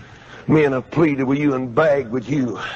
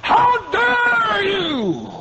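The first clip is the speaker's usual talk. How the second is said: much higher, slow drawn-out shout; shouted rebuke; much slower than usual. Acted. Preacher confronting the stingy flock in a slow roaring shout. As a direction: blast it across the tent. Preacher mocking self-satisfied givers.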